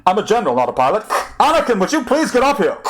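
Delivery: put-on high, clipped Core accent, faintly breathless; a flustered general in a clipped Core accent. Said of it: "a clipped core accent said, rather breathlessly".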